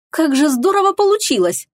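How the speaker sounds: high-pitched sarcastic cheer; biting fake enthusiasm; mock delight. A narrator's reading. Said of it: "хлопнула в ладоши Теруми".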